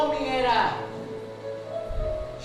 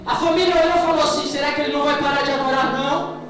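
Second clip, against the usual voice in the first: loud, pressing sermon narration; impassioned pulpit storytelling; building full-force preaching; 5 semitones higher, 9 dB louder than usual. Real, not acted. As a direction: drive the story louder toward a climax.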